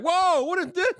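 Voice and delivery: high startled gasp of a question; mock shocked stylist; surprised. Hairdresser gawking at his head, high and surprised.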